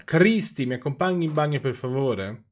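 high, loud plea to the maid; calling out. A narrator's reading. Wife calling the maid to take her to the bathroom, loud call. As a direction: keep shouting, then ask politely. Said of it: "urlò la donna".

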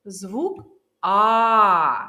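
"Звук А" with the А held long and loud; isolated vowel sound. Shown as a demonstration. Teacher names the first sound, "А" drawn out loudly. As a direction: stretch the А in "Звук А" into a sustained open vowel. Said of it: "Який перший звук у цьому слові".

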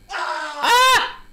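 loud, high startled yell; startled shout. Acted. A startled man yelling at the stranger by the table. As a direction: shout out in sudden fright. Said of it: "Don't shout at me".

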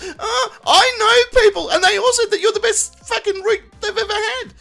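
high, begging ex-lover voice; pleading woman voice. Retold or imitated. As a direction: whine it like a clingy lover. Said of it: "messages on my answering machine".

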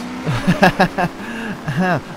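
high chuckling laugh. Real, not acted. Player apologising to other racers, amused laugh. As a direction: chuckle in a quick run.

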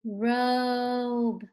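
"Robe" stretched on a long, held o; slow sounding-out. Shown as a demonstration. Teacher asks long O or long U, slow "Robe". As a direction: say "Robe" slowly, lingering on the vowel. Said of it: "Make sure you say it slow".

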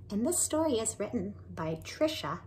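plain reading voice

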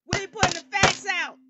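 loud, high declaration; aggressive shout. Real, not acted. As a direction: belt out the promise of facts. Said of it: "I'm feeling a little aggressive tonight".

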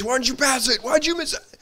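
a player griping at teammates in a high mock yell. Player berating teammates after a loss, high-pitched mock yell. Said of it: "they're yelling at their, their teammates".

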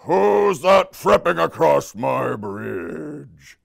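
slow, drawn-out troll call; menacing troll. Acted. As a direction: stretch every word like a threatening troll.

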